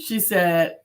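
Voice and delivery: usual speaking voice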